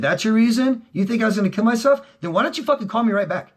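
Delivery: high, loud, fast angry tirade at his dad; retold furious rant. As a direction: yell it at the father like it's happening again. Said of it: "I said".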